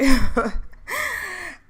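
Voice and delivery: squealing giggle at her own fake accent; high laugh at herself. Real, not acted. Host laughing at her own British-accent bit, high squealing giggle. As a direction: burst into a high embarrassed laugh.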